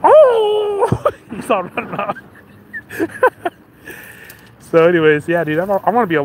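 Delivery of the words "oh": high loud yelp of being hit; mock pained yelp. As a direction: imitate a guy struck in the groin. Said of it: "And then you like".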